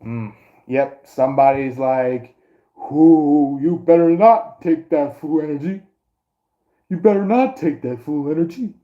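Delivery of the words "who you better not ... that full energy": mock scolding, higher than usual; lightly hammed-up "you better not"; fake warning voice. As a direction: warn them off in a put-on voice. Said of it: "like, a fake voice".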